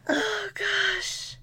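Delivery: high laughing "Oh, gosh". Real, not acted. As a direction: laugh through the words "Oh, gosh". Laughing after the joke.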